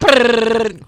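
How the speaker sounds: loud high buzzing brrr. Retold or imitated. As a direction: blast a loud lip buzz. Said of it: "I said".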